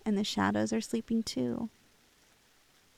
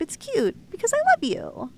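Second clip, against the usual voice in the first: excited gush; 12 semitones higher, 7 dB louder than usual. Acted. Yandere girlfriend gushing her love.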